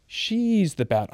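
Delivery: ordinary talk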